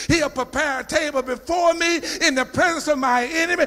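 loud, high climbing whoop; full-force preaching. Acted. Preacher rousing congregation, building to a shout.

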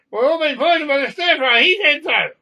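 loud, high, alarmed yell; screaming about poisoning. Acted. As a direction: raise the alarm at full voice. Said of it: "tell him to stop screaming about being poisoned".

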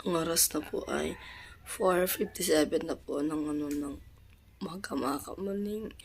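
soft, sleepy and hoarse; quiet sick late-night voice; hushed. Real, not acted. (mahina at paos na boses)

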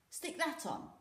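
put-on boyish Volga. A narrator's reading. Volga tossing helmet to Knut.

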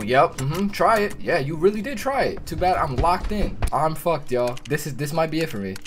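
usual speaking voice

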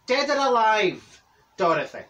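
cheeky jab. Retold or imitated. Cheeky put-down of friend.

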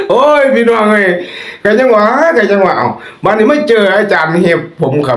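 amused exclamation. Real, not acted. Host calling to his listeners after a joke, amused. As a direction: call out to the listeners while amused. Still laughing, addressing listeners.

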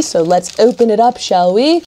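playful, badly faked British accent; amateur mock-British accent; terrible British accent. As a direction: put on a hammy British accent. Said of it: "my terrible British accent there".

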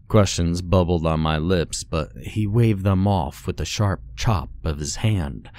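ordinary delivery

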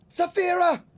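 loud, high cry of a name. A narrator's reading. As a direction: call out her name in alarm. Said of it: "cried Aragorn".

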